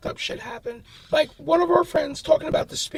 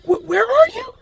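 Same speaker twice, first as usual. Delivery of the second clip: mom's alarmed question, much higher and louder; freaked out mother; 6 semitones higher, 8 dB louder than usual. Retold or imitated. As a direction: ask in sudden panic. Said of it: "my mom got so freaked out".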